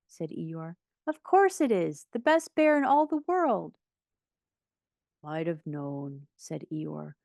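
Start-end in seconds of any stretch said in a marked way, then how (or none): 1.1-3.8 high, enthusiastic praise; delighted. A narrator's reading. Boy praising his bear before guests, high and delighted. 5.2-6.3 slow, low, soft resignation; dejected. A narrator's reading. Donkey learning the present is for Pooh, slow dejection. Eeyore learns gift isn't his.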